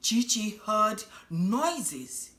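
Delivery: ordinary delivery, nothing marked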